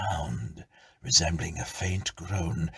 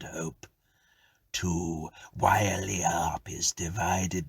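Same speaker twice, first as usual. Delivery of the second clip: mournful ghost. Acted. Ghost tells its story.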